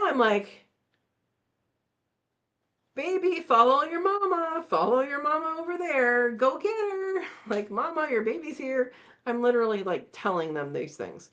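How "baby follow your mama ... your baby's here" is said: coaxing the cub. Retold or imitated. Coaxing bear cub away.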